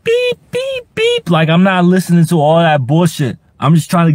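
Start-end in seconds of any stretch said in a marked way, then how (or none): 0.0-1.3 very high mock beeping; high mock beeps mimicking the car's alarm; imitated car beeps. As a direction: squeak out the car's beep.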